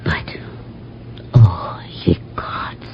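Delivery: slow, soft, breathless cry of dread; horrified whisper. Acted. Melissa crying out to the gods in horror, whispered. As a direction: gasp it out in horror, barely voiced.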